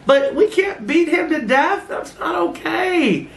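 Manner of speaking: put-on high, slow, whiny kid pleading; upset child voice. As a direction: plead like a crying six-year-old at the party. Voicing crying kids at party.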